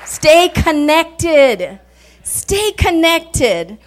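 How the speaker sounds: drawn-out, deliberate exhortation; urging slowly. Acted. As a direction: stretch each word to drive it home. Preacher urging congregation.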